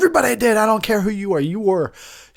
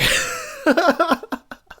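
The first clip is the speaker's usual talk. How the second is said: squealing laugh; very high laugh. Real, not acted. Host laughing at his own Mister T bit, high squeal of a laugh. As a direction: crack up high.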